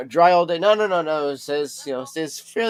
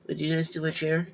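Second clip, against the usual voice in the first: normal talking voice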